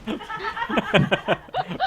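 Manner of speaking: high loud laugh. Real, not acted. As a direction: burst out laughing. Reacts to the AI clip.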